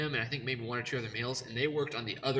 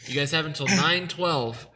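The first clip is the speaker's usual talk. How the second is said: loud, high announcer call; booming announcement; 4 semitones higher, 12 dB louder than usual. Acted. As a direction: boom it out like a game show host. Host booms deadline announcement.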